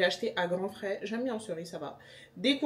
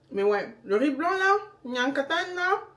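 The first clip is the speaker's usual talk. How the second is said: put-on playful Senegalese accent, high and loud; loud, high-pitched Senegalese-accented outburst; 7 semitones higher, 6 dB louder than usual. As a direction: slip into a lively Senegalese accent. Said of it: "excusez mon accent".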